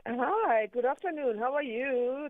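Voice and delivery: high, eager greeting; passionate. Real, not acted. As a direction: greet the host with real passion, voice lifted. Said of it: "I love the passion in your voice".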